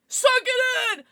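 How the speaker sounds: high, loud command to herself; fighting back tears with a yell; self pep shout. Real, not acted. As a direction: shout at yourself to stop crying. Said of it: "she's gonna cry".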